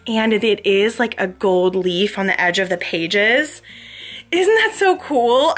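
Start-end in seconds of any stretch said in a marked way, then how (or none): 4.3-5.5 excited. Real, not acted. Excited about book detail.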